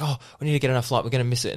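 high, hurried mock panic; frantic rushing. Flustered parent pestering check-in lady about the flight, mock-frantic rush. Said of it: "kind of just rushing her real bad".

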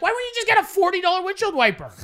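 high, rushed mock exasperation at a friend; incredulous. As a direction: fire it off in disbelief. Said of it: "I was like".

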